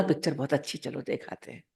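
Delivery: mock tempting murmur, dropping to breath; coaxing hush. Friend coaxing you to a film, hushed and tempting. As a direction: lure them in softly, as if sharing a secret.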